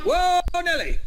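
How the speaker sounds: high, loud startled yelp; startled shriek. Real, not acted. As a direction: jump out of your skin. Jump scare in horror game.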